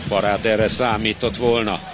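usual speaking voice

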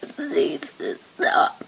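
slow, whispered and tearful; slow crying whisper. Real, not acted. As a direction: barely get it out through tears. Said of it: "I'm actually really crying".

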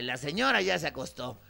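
usual speaking voice

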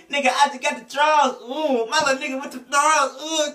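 high yelped rap voice. Retold or imitated. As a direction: mimic the rapper's strained flow. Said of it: "he'd be like".

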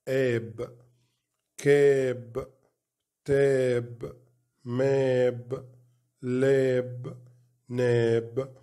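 each syllable then word, like فا then فات; phonics drill. Shown as a demonstration. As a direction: sound out "آت" slowly, part then whole. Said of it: "يبقى تتنطق إزاي؟". (تهجئة صوتية مقطع مقطع)

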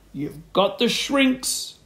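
slow accusing exclamation; accusing cry. A narrator's reading. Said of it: "cried Mister Swit".

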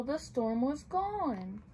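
usual speaking voice

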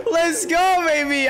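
high, cracking cheer; excited cheer. Real, not acted. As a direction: cheer through a laugh. Celebrating the goal.

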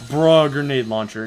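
loud, slow, thrilled shout; excited. Real, not acted. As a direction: draw it out, thrilled. Finds a grenade launcher.

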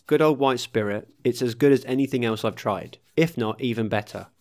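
usual speaking voice

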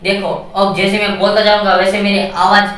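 ordinary delivery

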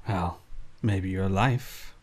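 low, slow threat; menacing thug. Acted. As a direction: drawl out the threat.

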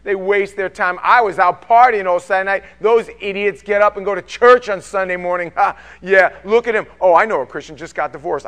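loud sneering mock of a scoffer. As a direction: jeer at the churchgoers. Preacher voices mocking partygoer.

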